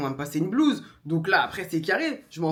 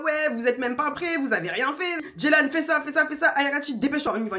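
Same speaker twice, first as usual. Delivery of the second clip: higher, pushy mom rushing everyone; imitating her bossy mother; 6 semitones higher than usual. Mother scolding the couple for not being ready, bossy and raised. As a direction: nag them like a mother hurrying the whole house. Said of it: "Elle est là".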